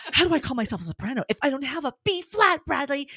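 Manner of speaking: fast, high mock-desperate rant; acted-out frustrated panic, pitch up; retold meltdown. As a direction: fire it at your husband in exaggerated despair. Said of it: "And I started crying".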